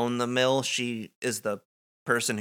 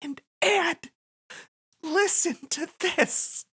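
Nadine voice; 11 semitones higher than usual. Acted. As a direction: build up to a high excited reveal. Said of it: "she says".

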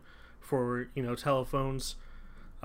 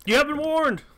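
high, booming mock warning; loud warning; 14 semitones higher, 8 dB louder than usual. Host warning listeners of spoilers, loud mock-dramatic. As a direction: belt out a dramatic warning, much higher. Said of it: "Sorry, was that loud?".

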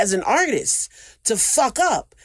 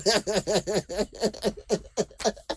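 soft laugh; 3 semitones higher, 5 dB quieter than usual. Real, not acted. Laughing at own joke.

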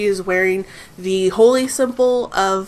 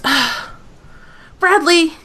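commanding woman; 9 semitones higher, slower than usual. Acted. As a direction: wave him off with authority. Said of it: "She goes".

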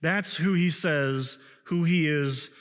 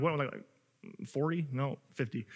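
mock muttered aside; 2 semitones lower, 8 dB quieter than usual.

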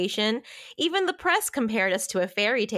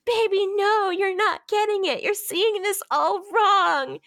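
pitched way up, a put-on girly heroine; mock heroine voice; 11 semitones higher, 4 dB louder than usual. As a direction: play the pleading heroine in a high put-on voice. Voicing the heroine in recap.

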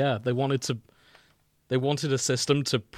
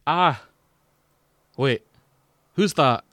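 Scottish Demo Man; 4 semitones higher, 7 dB louder, slower than usual. Acted. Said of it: "preference for Demo Man".

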